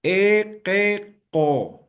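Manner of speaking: "E, que, co" high-pitched, one syllable at a time; syllable-by-syllable allophone vowels. Shown as a demonstration. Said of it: "Entonces, voy a pronunciar".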